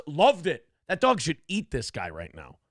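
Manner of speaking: ordinary talk, nothing marked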